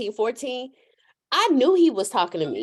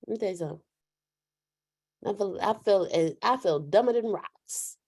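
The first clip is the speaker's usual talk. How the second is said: ordinary talk, nothing marked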